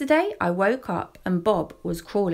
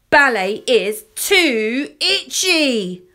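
put-on slow, high childish protest; whiny child complaining at full volume; very loud complaint; 4 semitones higher, 6 dB louder, much slower than usual. A narrator's reading. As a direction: announce it loudly, stretching each word. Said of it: "But I was very loud".

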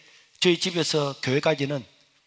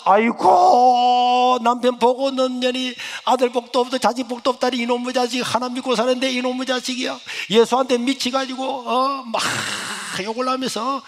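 wailing angry mother; 8 semitones higher, 5 dB louder than usual. Retold or imitated. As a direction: wail and curse your fate loudly. Mother wails and curses at meal. (한탄하며 울부짖는 어머니)

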